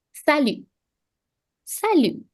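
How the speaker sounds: teacher introduces the informal salut, t left silent. Shown as a demonstration. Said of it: "the T at the end of salut is silent".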